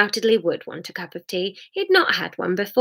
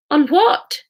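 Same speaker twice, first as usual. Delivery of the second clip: high, raised questioning call; 7 semitones higher, 4 dB louder than usual. A narrator's reading. Hamish calls after Jesus for an answer, raised call. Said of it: "called Hamish".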